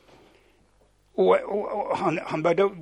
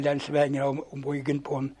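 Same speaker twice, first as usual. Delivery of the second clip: ordinary talk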